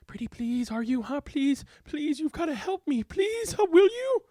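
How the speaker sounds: a pestering plea rising to a squeak; begging Goldberg. A narrator's reading. Goldberg begs, pitch rising.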